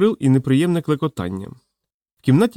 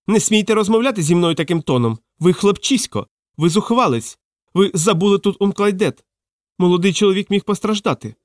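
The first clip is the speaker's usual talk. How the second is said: high-pitched indignant tirade; acted pompous outrage, pitched up; outraged fat man; 7 semitones higher than usual. A narrator's reading. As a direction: rail in a scandalised high voice. Fat man rants at Korneev.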